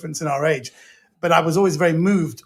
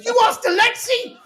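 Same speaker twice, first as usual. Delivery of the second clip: high-pitched shocked disbelief; incredulous dad. Retold or imitated. Dad hearing his son asked Alexei, shocked. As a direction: squeak it out like a stunned father. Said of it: "he goes".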